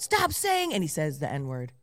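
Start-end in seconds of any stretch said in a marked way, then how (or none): 0.0-0.7 retold yell. Student's story of a teacher yelling at noisy kids, mock yell. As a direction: imitate a teacher's yell at ordinary volume. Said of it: "and he yells out".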